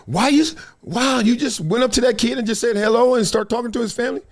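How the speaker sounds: high astonished onlooker; mock amazement. Onlookers seeing him greet a kid, mock amazement.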